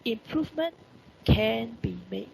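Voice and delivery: Improvement rising, can be made dragged out slowly; slow demo. Shown as a demonstration. Teacher slowly modelling the passive sentence for the class. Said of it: "started with the object".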